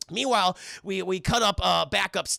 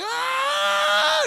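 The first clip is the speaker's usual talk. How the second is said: very high, loud shriek; high shriek of horror; horrified scream; 6 dB louder than usual. Acted. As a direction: scream at the monster. Family sees the monster.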